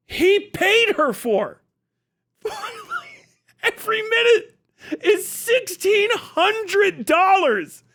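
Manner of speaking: cracking up over the price; laughing. Real, not acted. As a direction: laugh through the words. Laughing at debate cost.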